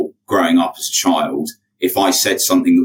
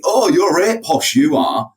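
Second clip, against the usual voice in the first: put-on Northern working-class accent; 10 semitones higher, slower than usual. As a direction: put on an exclaiming Northern English accent, pitched way up. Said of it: "chat to a working class local".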